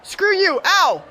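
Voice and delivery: very high, strained yelp; high-pitched hungover protest; pained snapping. Acted. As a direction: squeal an insult, then yelp in pain. Hungover, snaps back and hurts.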